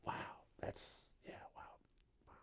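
barely audible, whispered wow; stunned whisper. Real, not acted. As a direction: trail into a hushed, unvoiced reaction. Stunned reaction to a disturbing search.

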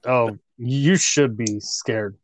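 ordinary talk

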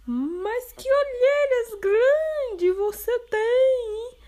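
put-on amazed girl. Girl marvelling at grandma's arms.

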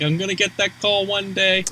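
wistful washed-up actor, lightly hammed; mock hopeful actor. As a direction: sigh out a dream of a comeback. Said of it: "He's like".

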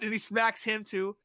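ordinary delivery

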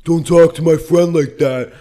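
hammed-up bar brawler warning. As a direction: play the burly lumberjack picking a fight. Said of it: "grabs Steven Seagal's arm and goes".

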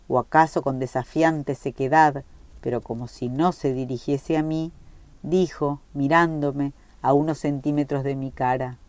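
ordinary delivery

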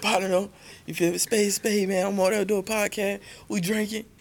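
put-on high-pitched drunk buddy voice; impression of buddy. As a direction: mimic your tipsy friend, pitched well up. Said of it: "Bro, you sound just like that".

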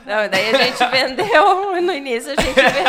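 laughing it off. Real, not acted. Laughs off partner's gigolo joke.

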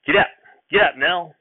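shouting at the horse. A narrator's reading. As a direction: call out loud to the horse. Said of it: "I heard Grandfather shout".

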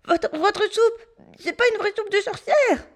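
put-on boy gasping with nose held; choked boy. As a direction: speak with nose pinched shut. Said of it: "en étouffant".

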